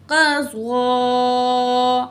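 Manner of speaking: قضا with a thick ق and long ضا; heavy drawn-out qaḍā. Shown as a demonstration. Teacher modelling the heavy letters, slow. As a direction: stretch the ضا of قضا. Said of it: "ضاد کھڑا زبر مد".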